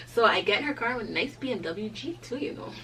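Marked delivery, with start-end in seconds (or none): none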